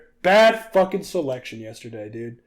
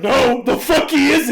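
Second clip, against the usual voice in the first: loud, higher-pitched indignant denial; retold outraged comeback, voice raised; indignant retort; 6 semitones higher, 5 dB louder than usual. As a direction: shoot the idea down loudly. Retold indignant reply to news.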